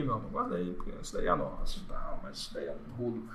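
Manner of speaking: hushed, secretive whisper to the wife; conspiratorial whisper. Retold or imitated. Husband whispering to his wife to hide the money, hushed. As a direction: let it drop to a sneaky whisper.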